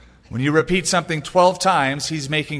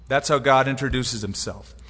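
ordinary talk, nothing marked

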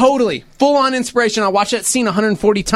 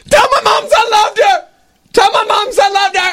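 much higher, loud dying cry; wounded soldier gasping; 11 semitones higher, 4 dB louder than usual. Retold or imitated. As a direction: shout last words to his mother as he falls. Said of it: "I'd be like".

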